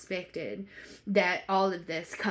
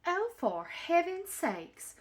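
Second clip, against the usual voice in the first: dismayed exclamation; 10 semitones higher, slower than usual. Acted. Exasperated Susie exclaims.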